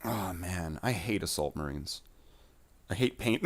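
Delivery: normal talking voice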